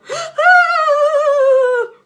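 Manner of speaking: long, loud, high sobbing wail; huge wail. Acted. Teacher outside the classroom lets out huge crying wail. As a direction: howl out your heartbreak. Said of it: "Then another wail".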